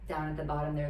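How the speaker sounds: ordinary talk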